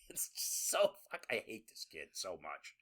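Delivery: ordinary talk, nothing marked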